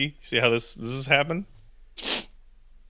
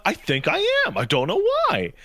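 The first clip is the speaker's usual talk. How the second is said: retold teary confusion; 9 semitones higher than usual. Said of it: "I'm like".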